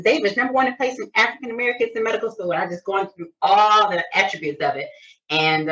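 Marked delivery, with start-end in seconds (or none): 0.0-2.5 quick and a little higher, eagerly selling the school; eager retelling. Retold or imitated. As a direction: rattle it off with enthusiasm to the young woman. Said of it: "I said".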